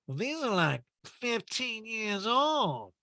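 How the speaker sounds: high, very drawn-out impression; Rinna. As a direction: mimic a friend stretching out every word. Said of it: "And Rinna goes".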